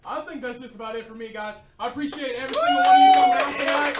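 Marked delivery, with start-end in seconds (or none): 1.8-4.0 shouted over applause, much louder; loud thanks. Real, not acted. Comic shouting goodbye to the crowd over music, loud.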